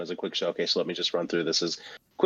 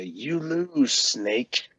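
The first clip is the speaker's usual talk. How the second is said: lightly voiced taunting victor; higher, drawn-out victory taunt, lightly acted; Ocelot; 4 semitones higher, much slower than usual. Ocelot taunting Solid Snake after a win, lightly imitated. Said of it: "But against Solid Snake, he'll say".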